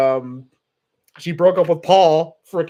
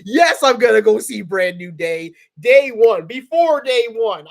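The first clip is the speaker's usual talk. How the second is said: loud, eager turnaround; excited; 4 semitones higher, 6 dB louder, slower than usual. Real, not acted. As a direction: blurt out the real answer with gusto. Eager reversal after fake-out.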